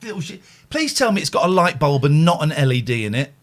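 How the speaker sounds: ordinary talk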